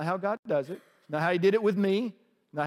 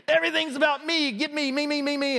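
put-on whiny baby voice; 9 semitones higher than usual. Mocking a toddler arching its back, high and whiny. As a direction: whine it like a selfish baby. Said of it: "It's like".

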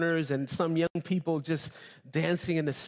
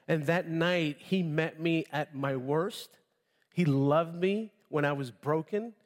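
usual speaking voice

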